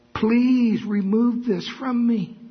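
high, slow pleading plea; pleading cry. Retold or imitated. Paul crying out to God, slow and high-pitched. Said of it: "he could say".

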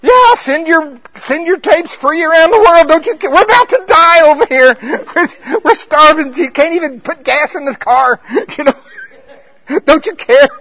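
frantic high loud cry for help; desperate sailors shouting at a sleeping Jesus; panicked disciples. Acted. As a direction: yell it like you're about to drown.

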